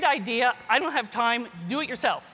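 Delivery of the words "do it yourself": normal talking voice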